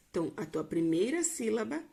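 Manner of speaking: usual speaking voice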